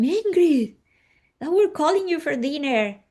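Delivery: high, loud call of the name. Retold or imitated. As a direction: call the child in to dinner from across the house. Lady calls child to dinner.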